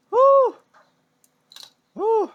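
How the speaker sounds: loud high whoop; cheering woo. Real, not acted. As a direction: whoop with joy.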